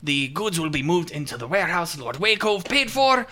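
high, loud pirate captain; a boisterous sea captain giving news. Acted. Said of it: "my pirate voice".

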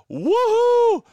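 high, loud joyful whoop; real radio-host whoop of joy; excited whoop. Real, not acted. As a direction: cheer it out with delight. Hears guest will attend Friday.

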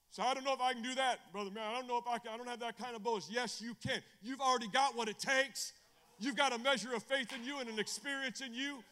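high, loud, insistent pulpit voice; forceful preaching. Real, not acted. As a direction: urge the doubters on with conviction.

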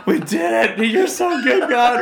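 very high, loud retold rejoicing; elated thanks. Speaker acting out thanks to God for provision, elated. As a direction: cry out thanks, half laughing.

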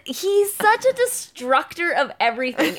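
ordinary talk, nothing marked

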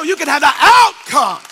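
loud, high sermon voice; preaching at full force. Acted. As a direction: drive it home to the congregation.

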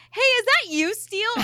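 high, loud imitation of a surprised friend; retold astonished call-out. Friend retells someone recognizing Steele on the screen, astonished. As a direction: call out as if just spotting him.

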